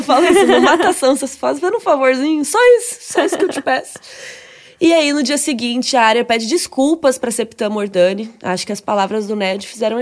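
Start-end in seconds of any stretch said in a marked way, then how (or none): 0.5-3.9 high, laughing plea for a small favour; half-laughing, hammed-up entreaty; mock pleading. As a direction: beg playfully, pitch leaping up. Jokingly acting out a parting plea.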